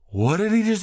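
high-pitched baffled panic, acted out; mock freaked-out. Tripping friends puzzling over the helicopter, mock freak-out. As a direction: imitate a tripping friend in confused alarm. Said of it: "They were all fucking freaking out".

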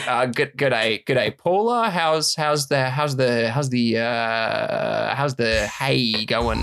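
stumbling mock Aussie accent, slowing down; Australian accent. Aussie farmer asking a neighbour about the hay in an Australian accent. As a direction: fumble through it like an Australian farmer. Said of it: "Australian accent too".

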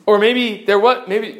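usual speaking voice

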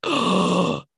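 re-enacted shocked gasp; retold gasp. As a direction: gasp as if just hearing the news. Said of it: "I was like".

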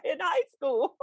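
high, excited recollection, laughing through it; excited gush. Retold or imitated. S1 telling S0 her first impression, excited and high. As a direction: gush about the dance teacher.

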